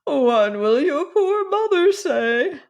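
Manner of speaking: high, slow lament; a fretful old woman's wail; moaning. Acted. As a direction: moan it like a mourning aunt. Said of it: "moaned Cousin Georgiana".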